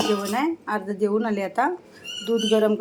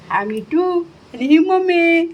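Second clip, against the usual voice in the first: doting on the parrot; 5 semitones higher, 4 dB louder than usual. Real, not acted.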